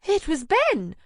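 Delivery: high, loud screech. Acted. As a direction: shriek the name out. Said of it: "She turns around and screeches".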